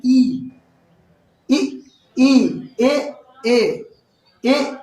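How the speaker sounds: ई, ए, ऐ sounded out slowly one by one; front vowel demo. Shown as a demonstration. Teacher modelling front vowels for students. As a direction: say each front vowel clearly and apart. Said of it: "इनका उच्चारण आप करके देखिए".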